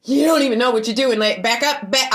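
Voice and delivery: loud angry telling off; angry retold yell; yelling at the woman. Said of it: "And I was like".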